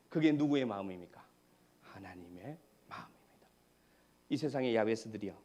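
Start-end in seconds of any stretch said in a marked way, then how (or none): 1.8-3.5 hushed, breathy whisper; 부모의 마음이에요 in a soft whisper; whispered answer. Acted. Answering own question hushed.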